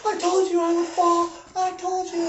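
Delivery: mock-whine it like a terrified kid; whiny scared kid. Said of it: "And he's like".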